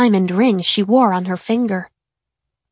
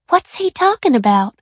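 ordinary delivery, nothing marked

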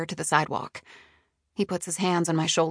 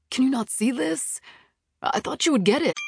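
higher-pitched, frustrated appeal; pleading frustration; exasperated plea; 6 semitones higher than usual. A narrator's reading. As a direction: urge her to understand. Boss pleads to be understood.

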